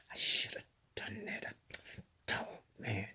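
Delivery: whispered regret to herself; regretful whisper. Retold or imitated. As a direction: let it slip out under the breath. Inner regret voiced as whisper.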